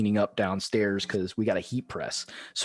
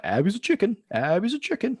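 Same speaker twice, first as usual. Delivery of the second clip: put-on higher singsong kid taunt, louder; son's taunt; 11 semitones higher, 4 dB louder than usual. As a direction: tease like a little brother chanting. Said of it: "my son starts walking around".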